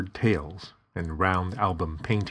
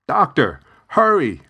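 loud, high, out-of-breath cry for the doctor; breathless urgent call; 9 semitones higher, 8 dB louder than usual. Acted. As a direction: burst in puffing and shout for help. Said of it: "huffing and puffing".

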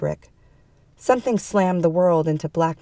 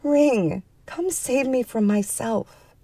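begging; 7 semitones higher, slower than usual. A narrator's reading. Woman begging the silent phone, pleading. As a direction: plead desperately, drawing it out. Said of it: "she begged it".

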